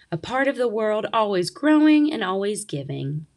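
ordinary delivery, nothing marked

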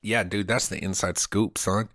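ordinary talk

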